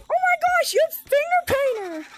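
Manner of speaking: mock-excited high voice of another player; imitating excited kid. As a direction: mimic the gushing kid. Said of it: "this guy's like".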